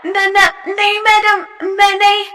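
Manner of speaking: panicked refusal. Acted. A frightened girl refusing her teacher, flustered. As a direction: back away from the offer.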